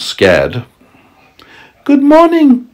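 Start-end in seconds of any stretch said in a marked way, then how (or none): 1.8-2.7 put-on polite little girl, very high; sweet young girl's greeting. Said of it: "she said politely".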